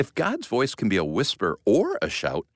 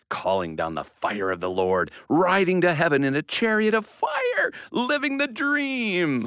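excited; 6 semitones higher than usual. Acted. Kid acting out Elijah excitedly.

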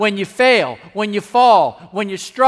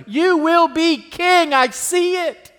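higher, slowed, fervent declaration of faith; Jonathan encouraging; 9 semitones higher, slower than usual. Acted. Jonathan assuring David of his future, high and fervent. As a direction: lift it up and lean on every word. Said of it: "futuristically to us".

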